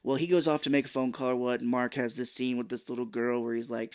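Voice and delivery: ordinary delivery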